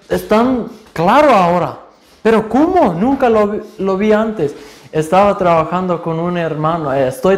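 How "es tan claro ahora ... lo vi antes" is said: higher, wide-eyed astonishment at seeing sin; the sudden shock of finally seeing; amazed realization. Retold or imitated. New believer suddenly seeing his sin, amazed.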